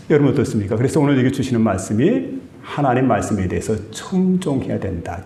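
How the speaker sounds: normal talking voice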